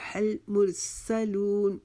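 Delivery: slow हल मुरसलून, no bounce on l; careful model, long "loon" in मुरसलून; recitation demo. Shown as a demonstration. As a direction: chant हल मुरसलून with a drawn-out last syllable. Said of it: "लाम की जज़म के ऊपर".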